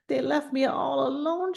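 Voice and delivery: high, tearful little cry. A narrator's reading. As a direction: let her voice crack as she cries. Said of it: "she cried".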